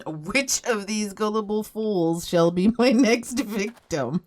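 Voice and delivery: slow, hammed-up scheming villain, breaking into a laugh; slow, hammed-up evil plotting with a giggle; mock sneaky villain. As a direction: drawl it like a cartoon schemer. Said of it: "peek around and go, "Hmm".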